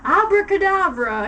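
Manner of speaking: loud high spell shout; yelling. A narrator's reading. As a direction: yell the spell. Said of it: "he yelled at Snape and Lupin".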